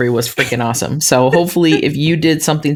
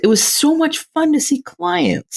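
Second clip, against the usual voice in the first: tearful; 11 semitones higher than usual. Real, not acted. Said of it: "Are you making me cry already?".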